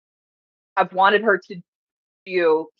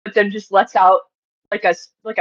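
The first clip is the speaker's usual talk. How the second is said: usual speaking voice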